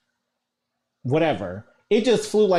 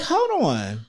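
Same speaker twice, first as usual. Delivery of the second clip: high-pitched, rising disbelief as recognition hits; retold dawning recognition; 13 semitones higher than usual. Soap fan retelling hearing a voice on the phone, excited recognition. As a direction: replay hearing a familiar voice, pitch climbing high.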